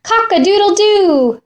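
high crowing cock-a-doodle-doo; rooster crow. Acted. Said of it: "said the rooster".

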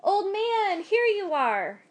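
high voiced wife, slow relief; relieved wife. A narrator's reading. Wife greeting her husband at the door, high and relieved. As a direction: greet husband home at last. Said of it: "standing by the door".

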